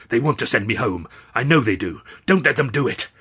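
loud, hoarse, panicky pleading; agitated old man begging a friend. A narrator's reading. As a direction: plead urgently, building in pitch at the end. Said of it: "He was quite agitated".

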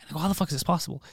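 ordinary delivery

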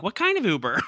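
deflecting question. Retold or imitated. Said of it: "Crystal tried to deflect, being like".

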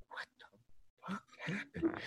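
slow, voiceless whisper of disbelief; stunned whisper. Retold or imitated. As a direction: whisper it to yourself, dumbfounded. His stunned reaction after being dismissed.

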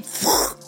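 voiceless whoosh of air; imitated puff. Woman imitating the portal's puff, voiceless whoosh. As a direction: blow out a short fizzle noise. Imitates the fizzle after command.

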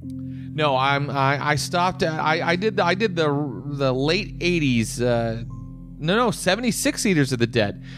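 normal talking voice

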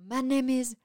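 halting attempt at a French accent; bad French accent. Shown as a demonstration. Trying accents on request, bad French accent. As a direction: put on a clumsy French accent. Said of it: "Uh, French".

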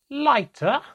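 very high, loud, incredulous cry; choking with laughter. A narrator's reading. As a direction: get the name out as if through tears of laughter. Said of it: "through the tears and the laughs".

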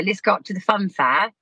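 ordinary talk

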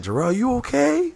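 high, worried voice of a passing reporter; concerned reporter. Retold or imitated. Reporters passing by ask in high, worried voices. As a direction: play a coworker checking on him. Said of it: "they would've been like".

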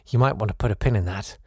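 ordinary delivery

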